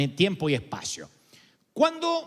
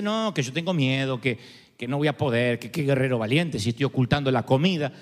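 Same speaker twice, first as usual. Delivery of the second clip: fearful Gideon; 8 semitones lower than usual. Retold or imitated. Gideon protests his fear.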